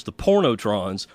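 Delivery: normal talking voice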